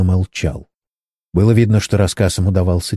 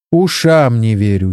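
usual speaking voice

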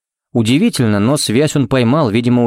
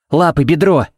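higher, urgent call; urgent instruction; 5 semitones higher than usual. A narrator's reading. Kevin directing where to inject the bear, urgent call. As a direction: fire off a quick raised order.

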